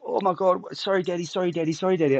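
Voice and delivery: put-on high, rushed apologetic child voice; a child's flustered apology, lightly voiced; mimicking daughter's panic. Imitating his daughter panicking after calling him by the wrong name. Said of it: "she goes into this absolute heap".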